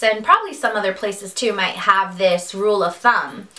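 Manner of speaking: usual speaking voice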